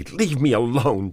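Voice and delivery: high, loud desperate cry; woman crying out as he grips her arm. A narrator's reading. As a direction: shout it to push him off. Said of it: "she cried".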